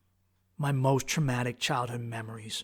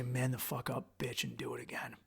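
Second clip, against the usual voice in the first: muttered low self-command, much softer; tough inner pep talk; 4 semitones lower, 13 dB quieter than usual. Retold or imitated. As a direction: grit it under your breath to yourself. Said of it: "I say".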